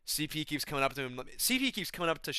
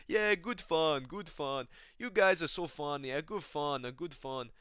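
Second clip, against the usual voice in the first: Shamil; 3 semitones higher, slower than usual. Retold or imitated. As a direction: imitate an oblivious pal joining the fun. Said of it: "He's like".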